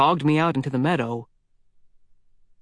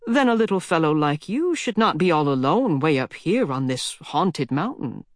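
put-on old mountain lady, teasing; 2 semitones higher than usual. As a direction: spin it like a granny's spooky tale. Old lady warns the boy.

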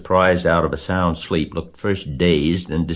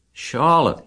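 high, falling moan of a name; 11 semitones higher, 4 dB louder than usual. A narrator's reading. Wilbur grieving for his dying friend, moaning. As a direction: moan it out in grief. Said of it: "he moaned".

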